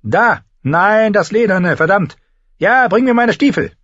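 loud, high, harried commands; impatient high-voiced barking; barking orders. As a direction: snap out hurried orders while dressing. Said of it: "bellte er Shay an".

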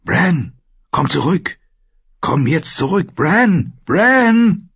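high-pitched calling, building to the last name; urgent calling. A narrator's reading. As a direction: call someone back from far away. Voice calling Bran back from dream. (drängendes, hohes Zurückrufen)

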